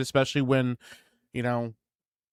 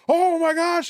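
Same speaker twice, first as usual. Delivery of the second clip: high, louder mock panic; retold alarmed cry, pitched way up; freaking out; 5 dB louder than usual. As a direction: imitate a crew panicking at an accident. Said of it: "and they're freaking out".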